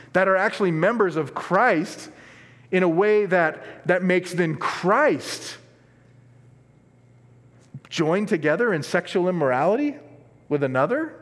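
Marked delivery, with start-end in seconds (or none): none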